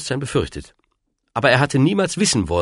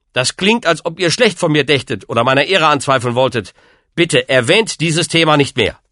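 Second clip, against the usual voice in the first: mildly indignant, higher-pitched pleading; a touch of hurt honour, voice raised; earnest protest; 6 semitones higher, 4 dB louder than usual. A narrator's reading. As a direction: defend yourself with rising urgency. Lancelot defends himself against reproach.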